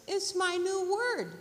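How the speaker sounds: high little bird voice; eager bird. Acted. Bird telling squirrel its new word, high and eager. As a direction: pipe it up, loud on the last word.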